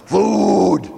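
loud drawn-out call for food; gut yelling. Acted. The old gut yelling for food. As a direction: yell it like a hungry belly. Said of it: "the old gut's yelling".

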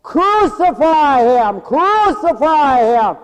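loud, high, slow crucify chant; crowd shouting. Retold or imitated. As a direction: yell it like a hostile mob. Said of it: "they shouted all the more".

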